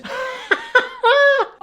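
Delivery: high-pitched helpless laugh; burst of laughter. Real, not acted. Laughs at the smoking toast.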